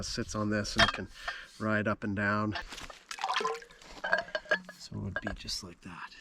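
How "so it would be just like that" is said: whispering. Real, not acted. Quiet aside demonstrating dock.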